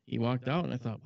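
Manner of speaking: ordinary talk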